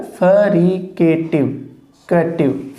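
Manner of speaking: Fricative drawn out slowly, sound by sound; teacher slowly modelling fricative; slow spelled-out demo. Shown as a demonstration. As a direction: stretch fricative out for the class. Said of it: "phonetic symbol है".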